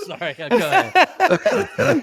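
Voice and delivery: words squeezed out through laughter; can barely talk for laughing. Real, not acted. Apologizing to cohost while still laughing hard. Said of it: "You're making me laugh too hard".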